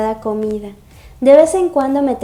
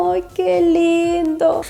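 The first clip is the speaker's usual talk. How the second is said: gushing squeal; 6 semitones higher, much slower than usual. Real, not acted. Gushing over the couple.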